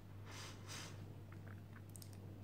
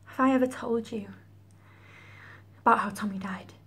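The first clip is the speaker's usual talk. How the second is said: tragic heroine sorrow. Acted. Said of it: "That's a feeling".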